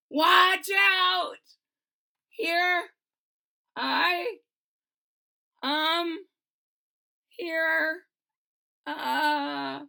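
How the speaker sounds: loud start that crumbles into hesitation; faltering bravado. Acted. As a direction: start big then lose your nerve. Courage fails at slide top.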